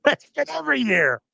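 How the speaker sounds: laughing high through It's been every year. Real, not acted.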